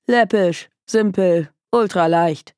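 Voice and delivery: deadpan, word-by-word verdict; toneless. A narrator's reading. Detective dismissing the client's case, toneless. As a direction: list them off without feeling. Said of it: "sagte Anne tonlos".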